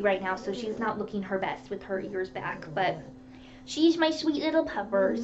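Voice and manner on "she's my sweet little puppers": high, cutesy doting voice; doting pet talk. Real, not acted. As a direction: coo over your little dog in a higher pitch. Dotes on her pug.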